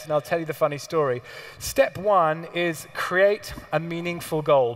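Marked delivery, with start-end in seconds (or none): 1.6-4.7 slowly, each word spelled out; slow dictation. Shown as a demonstration. Coach dictating the first step to the audience, slow and deliberate. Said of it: "Please write that down".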